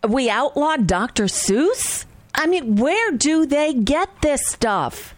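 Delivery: incredulous. Real, not acted. Host mocking a politician's claim, slow incredulous disbelief. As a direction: repeat the claim like you can't believe it.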